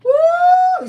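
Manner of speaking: high loud whooping cry; delighted whoop. Real, not acted. Auntie whooping at her live viewers in delight.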